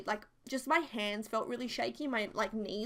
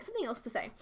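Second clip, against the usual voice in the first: usual speaking voice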